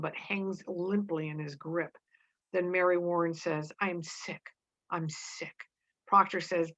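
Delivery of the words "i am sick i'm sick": weak and faint. Acted. Mary weak and ill.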